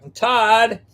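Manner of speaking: high, held host introduction, repeated; insistent announcer cue. Pretend. Host cueing his co-host to say her name, insistent announcer call. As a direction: prompt your partner with a high intro.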